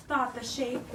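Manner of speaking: ordinary talk, nothing marked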